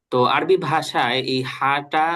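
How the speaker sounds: ordinary talk, nothing marked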